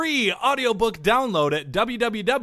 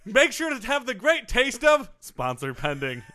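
ad read; 5 semitones higher, 4 dB louder than usual. Pretend. Mock ad read.

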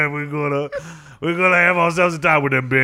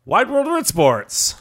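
much higher, stretched announcer shout; announcer intro; 13 semitones higher, much slower than usual. Acted. Hosts open their sports segment with a booming announcer shout. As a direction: belt it like a TV show opener.

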